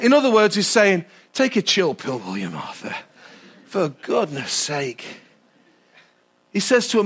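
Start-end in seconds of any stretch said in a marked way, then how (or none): 1.3-5.0 calming aside. Acted. Jesus telling Martha to relax, low and soft. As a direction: settle her down with a low murmur.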